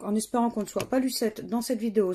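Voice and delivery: ordinary talk, nothing marked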